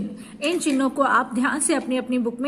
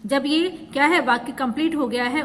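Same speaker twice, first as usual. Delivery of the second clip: ordinary talk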